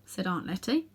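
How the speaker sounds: ordinary delivery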